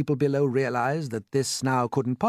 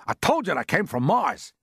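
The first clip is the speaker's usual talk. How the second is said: raised, insistent voice; called out; 7 semitones higher, 4 dB louder than usual. A narrator's reading. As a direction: call it out emphatically. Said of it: "called the chief of police".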